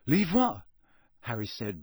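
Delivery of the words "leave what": higher, puzzled question; bewildered. Acted. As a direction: ask it in confusion.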